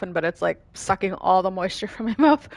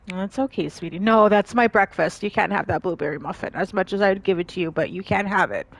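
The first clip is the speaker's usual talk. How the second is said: ordinary delivery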